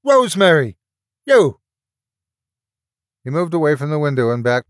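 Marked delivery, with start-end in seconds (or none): 0.0-1.6 high, loud angry call; single her out with a shout. A narrator's reading. Mark calls Rosemary out from the window, shouting.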